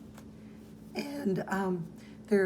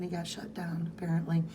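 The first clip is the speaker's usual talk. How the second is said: ordinary talk, nothing marked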